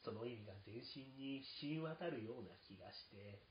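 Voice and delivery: ordinary delivery, nothing marked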